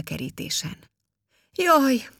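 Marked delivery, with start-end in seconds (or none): 1.5-2.1 high, loud yelp of dismay; crying out. A narrator's reading. Boy sees his ball fly over the fence, crying out high and loud. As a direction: cry out, pitched well up. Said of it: "kiáltott Peti".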